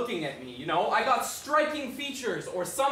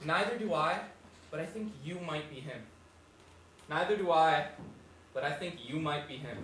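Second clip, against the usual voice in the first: imitated posh boy, trailing softly; lightly mocking posh child, dropping quiet; posh little voice; 4 semitones lower, 5 dB quieter than usual. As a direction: mimic the sweet little kid's posh accent. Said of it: "he's got this posh little voice".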